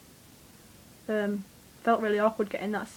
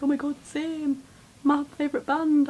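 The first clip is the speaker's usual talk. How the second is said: put-on excited fan girl; 5 semitones higher, 3 dB louder than usual. Voicing the excited security girl.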